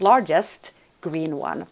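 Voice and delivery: ordinary talk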